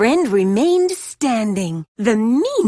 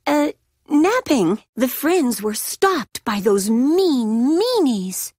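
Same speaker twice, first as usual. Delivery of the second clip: usual speaking voice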